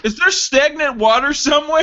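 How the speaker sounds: high, incredulous complaint; hammed-up revolted bewilderment; mock disgust. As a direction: play someone hunting for a foul smell. Playing someone hit by the stench.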